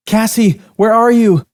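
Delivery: high, loud desperate call; crying out. Acted. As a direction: call out frantically for a lost friend. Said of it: "a young waitress cried out".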